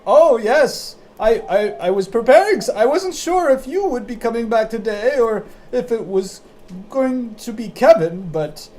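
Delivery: put-on high, loud old lady voice, a bit fast; a little old lady straining to hear; deaf old lady.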